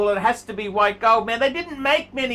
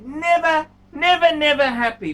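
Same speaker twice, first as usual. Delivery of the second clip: higher, louder, fed-up scolding; exasperated shout; 7 semitones higher, 7 dB louder than usual. Real, not acted. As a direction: throw up your hands at him. Scolding the picky owner.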